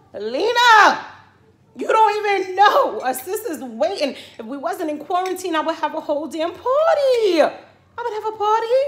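high, loud, gleeful calling out; excited shout. Real, not acted. Fan calling out to Lena about the new season, excited shout. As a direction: holler it to her with pure joy.

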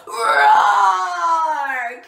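loud, drawn-out lion's roar. A narrator's reading. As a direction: let out a big startled lion roar. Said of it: "and he roared".

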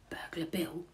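plain reading voice

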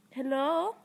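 ordinary talk

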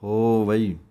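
ਹੋਵਈ with separate ਵ and ਈ, not ਹੋਵੀ. Shown as a demonstration. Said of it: "ਹੋਵੀ ਪਾਠ ਨਹੀਂ ਪੜ੍ਹਨਾ". (ਹੋਵਈ ਨਾ ਕਿ ਹੋਵੀ)

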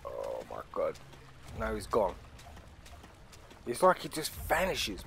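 ordinary talk

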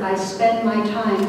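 ordinary talk

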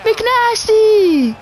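high, drawn-out celebratory hollering; extra hyped up. Real, not acted. Gamer celebrating his player McNasty, extra hyped up. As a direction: holler it up high, over the top with hype. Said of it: "sorry if I'm extra hyped up".

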